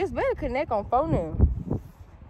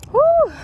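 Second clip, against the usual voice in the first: high, loud puff of relief; loud whew; 9 dB louder than usual. Real, not acted. Winded after chasing trash, loud whew. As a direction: blow out a big high "whew".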